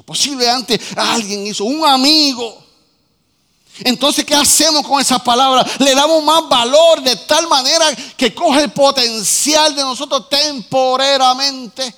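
loud, driving sermon shout; preaching at full force. Acted. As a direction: preach it hard to the congregation. Predica con fuerza. (predicación a todo pulmón, gritando)

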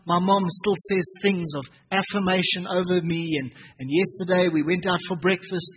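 ordinary delivery

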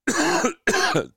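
one quick, high throat-clearing cough; deliberate cough. Real, not acted. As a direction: cough once into the mic on purpose. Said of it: "Hold on, courtesy cough".

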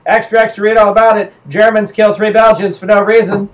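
high, loud mock newsboy; newsboy propaganda cry. US propaganda crying out headlines, high loud newsboy call. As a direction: hawk the headline like a street paperseller. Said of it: "United States propaganda was like".

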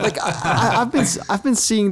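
laughing. Real, not acted. Laughing as he starts.

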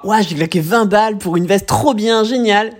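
high, gushing mock delight; mock excitement. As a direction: imitate a thrilled shopper. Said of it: "Mais nous finissons par".

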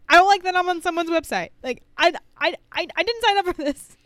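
high, loud and fast complaint; frantic retold panic. Calling her co-host frantically about the website, fast and loud. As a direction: replay the frantic phone call. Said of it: "being like".

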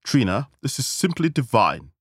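high, swooping society-lady praise; gushing praise. Acted. Dinner guest praises the hostess, gushing. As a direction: gush it with a big falling swoop.

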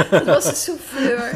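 amused laughing repeat. Real, not acted. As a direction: echo the joke through laughter.

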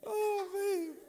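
high wheezing real laugh into "oh, man"; helpless laughter. Real, not acted. Preacher cracking up at his own deal, helpless laughter. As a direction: laugh till the words come out squeaky.

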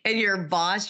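usual speaking voice

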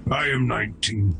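ordinary talk

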